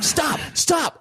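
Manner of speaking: high reenacted cry of alarm; retold screaming. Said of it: "so many screaming".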